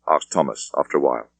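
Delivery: ordinary delivery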